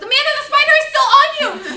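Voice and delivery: put-on high, loud, fast alarmed child's shout; panicked shout. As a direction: yell the warning in panic, fast. Child warns of spider on teller.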